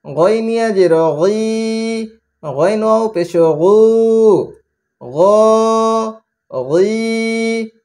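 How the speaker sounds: chanted Arabic letter-by-vowel recitation with rising ends; sing-song spelling drill. Shown as a demonstration. Teacher drilling pupils in Quran reading, chanting. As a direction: recite each syllable like a classroom chant.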